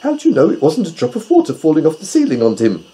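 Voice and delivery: quick, high, doubting voice; Gub-Gub. A narrator's reading. Pig questions owl about the tear, quick and high. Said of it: "asked Gub-Gub".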